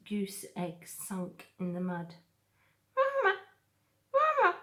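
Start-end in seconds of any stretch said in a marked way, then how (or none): 3.0-4.6 very high, tiny muffled peep. Acted. Gosling calls from inside the egg, tiny and high. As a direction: peep it like a chick inside the shell. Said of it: "peeped a tiny muffled voice".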